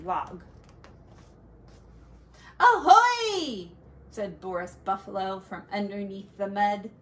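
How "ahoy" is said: loud, high hail from below; cheerful call. Acted. Boris hails the bear from the mud, loud cheerful call. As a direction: call out ahoy to startle the bear.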